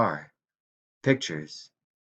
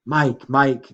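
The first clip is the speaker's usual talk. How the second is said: louder, higher insistent call; urgent pleading; 5 semitones higher, 5 dB louder than usual. A narrator's reading. Grandmother calling Mike to answer her, urgent pleading.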